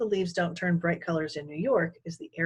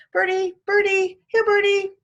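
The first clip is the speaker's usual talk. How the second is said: put-on high, loud little-kid call; calling toddler; 14 semitones higher, 9 dB louder than usual. A narrator's reading. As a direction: coax the birds like a toddler. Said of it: "he called".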